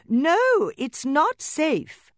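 high, loud, slow call; calling from downstairs. Acted. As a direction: call a warning up the stairs. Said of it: "Mama doll called from downstairs".

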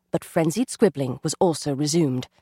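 plain reading voice

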